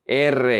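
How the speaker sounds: R as "erre" with a tongue trill; rolled R. Shown as a demonstration. Teacher showing the hardest Spanish sound, rolled R. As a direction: roll the tongue through the R. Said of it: "need to roll your tongue".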